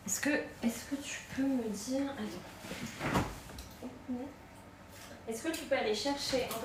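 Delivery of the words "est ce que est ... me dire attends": whispering. Real, not acted. Whispering aside to child off-stream.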